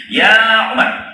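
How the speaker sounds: calling out. Acted. Prophet calls for Umar.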